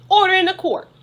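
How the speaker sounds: hammed-up angry judge; stern judge. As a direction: bang the gavel and demand order. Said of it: "If somebody talks who's not supposed to, you say".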